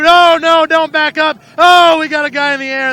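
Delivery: loud, high yell of warning; announcer shrieking as a car flies; alarmed shout. Real, not acted. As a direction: shout at the driver not to back up. Car backing up, then airborne.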